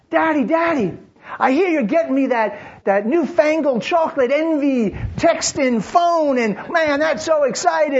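put-on high, eager child voice, bubbling over; a daughter running to greet her dad, eager and high; excited little daughter. Daughter excited about a new phone, high and eager.

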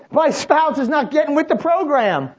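high, loud whining grievance; mock complaint. A believer griping to God about a spouse, loud and whiny. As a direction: exaggerate the complaint.

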